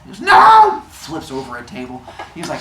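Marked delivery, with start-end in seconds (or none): 0.2-0.8 very high, very loud mock tantrum shout; furious shout. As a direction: scream it like a toddler's tantrum. Zeus flips table.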